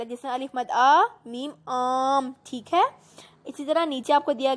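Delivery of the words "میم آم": آم with the long آ drawn out slowly; stretched madd vowel. Shown as a demonstration. Teacher showing pupils how to stretch madd. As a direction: hold the آ in آم long. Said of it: "اس لفظ کو لمبا کر کے پڑھتے".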